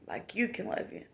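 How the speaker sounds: like you can love you, dropped to a breathy whisper; soft whisper. Acted. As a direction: let the line fall to a hush.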